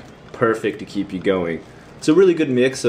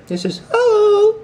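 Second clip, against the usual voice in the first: surprised; 5 dB louder, slower than usual. Real, not acted. Sudden squeal over the shrimp.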